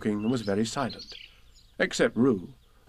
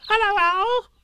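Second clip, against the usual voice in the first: very high, loud little squeak; a tiny excited joey squeaking hello; 6 dB louder, slower than usual. Acted. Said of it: "So he said".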